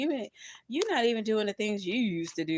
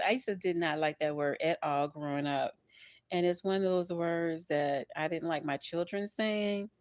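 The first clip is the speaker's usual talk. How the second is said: ordinary delivery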